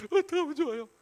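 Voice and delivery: high, loud pretend wail; acted helpless plea. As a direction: plead for help in mock despair. Moses pleads back to God.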